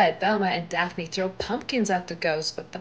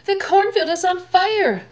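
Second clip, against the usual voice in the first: much higher, louder panicked call; alarmed cry; 12 semitones higher, 5 dB louder than usual. Acted. Someone seeing the burning cornfield, higher and loud with alarm. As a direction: sound the alarm at the fire.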